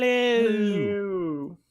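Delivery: drawn-out high spooky hello; grim reaper voice. Retold or imitated. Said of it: "that's how Grim Reaper talks".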